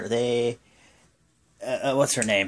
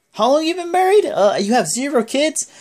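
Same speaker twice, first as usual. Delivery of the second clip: high, loud incredulous needling; mocking taunt; 13 semitones higher, 5 dB louder than usual. Wrestler taunting rival about having kids, high-pitched mockery. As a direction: imitate a wrestler jeering at his rival.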